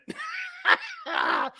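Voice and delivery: shrieking high laugh. Real, not acted. Host howls at his own taunt, shrieking laugh. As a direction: howl with laughter.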